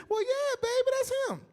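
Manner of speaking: put-on high sweet old lady voice. Preacher quotes the old lady at the flea market, high and sweet. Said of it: "She said".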